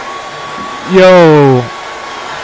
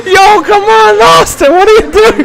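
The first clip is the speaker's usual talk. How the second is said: much higher, pleading disbelief; incredulous yelling; 11 semitones higher than usual. Real, not acted. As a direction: call out to Austin through the screen. Yelling at Austin on screen.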